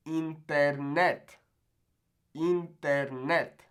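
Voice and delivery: "Internet" slow, in even Spanish syllables; Spanish pronunciation. Shown as a demonstration. Teacher models the word for learners, slow Spanish pronunciation. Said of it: "Not internet".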